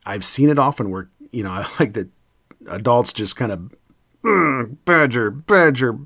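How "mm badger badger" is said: pushy nagging adult. Retold or imitated. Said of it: "and just push and push and push".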